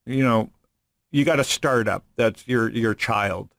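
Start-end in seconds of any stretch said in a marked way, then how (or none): none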